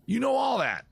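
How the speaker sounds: high, exasperated comic protest; mock outrage. Host scolding chat for pushing him, mock outraged. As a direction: pitch shoots up in hammed-up indignation.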